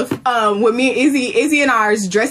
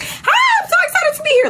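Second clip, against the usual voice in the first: mock outburst. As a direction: ham up the forbidden excited hello. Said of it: "Don't stand on stage and be like".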